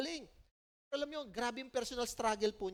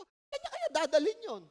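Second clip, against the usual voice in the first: mock inner voice; 6 semitones higher, 4 dB louder than usual. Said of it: "Isip ko".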